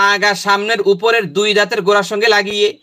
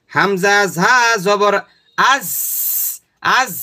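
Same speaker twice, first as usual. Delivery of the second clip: slow spelling out; much slower than usual. Shown as a demonstration. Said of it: "এখন উচ্চারণ করেন".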